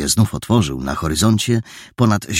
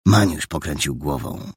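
plain reading voice

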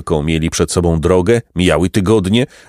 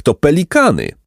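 higher, eager call; excited cry; 7 semitones higher than usual. Acted. Jacek spotting pelicans on the lake, excited cry. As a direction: point out the birds with a shout. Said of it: "krzyknął Jacek".

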